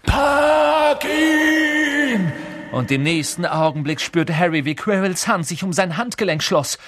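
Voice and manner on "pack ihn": high, loud drawn-out scream; screamed order. A narrator's reading. Voldemort screams at Quirrell to seize Harry. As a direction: scream the command. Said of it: "doch Voldemort schrie".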